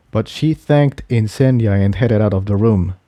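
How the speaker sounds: plain reading voice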